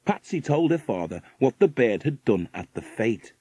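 ordinary delivery

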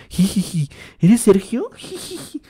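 high giggly nervous fan; nervous laugh. Retold or imitated. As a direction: giggle nervously between words. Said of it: "Esa risa nerviosa como". (risa nerviosa aguda)

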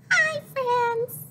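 very high loud squeaky piggy hello; be the little pig puppet. Acted. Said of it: "say hi to Piggy".

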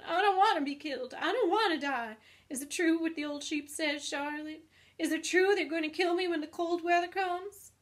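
high, loud panicked pleading; frantic screaming. A narrator's reading. Pig racing up and down begs the spider, screaming. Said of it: "screamed Wilbur, racing up and down".

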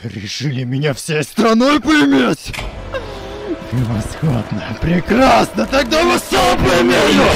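slow, loud, high enraged roar; raging gamer. Acted. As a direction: explode with unhinged fury. Hero snaps, curse unlocked.

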